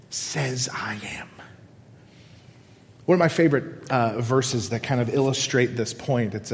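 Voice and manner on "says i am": soft breathy whisper, slowed down. Real, not acted. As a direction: let it fall to a hush. Sermon climax, dropped to whisper.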